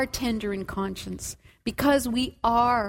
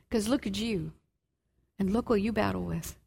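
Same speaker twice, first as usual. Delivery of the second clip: soft, lower, fast sneer; mock taunt; 6 semitones lower, 6 dB quieter, faster than usual. Preacher voicing failure's sneer at the weary believer. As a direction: belittle them under your breath, quickly.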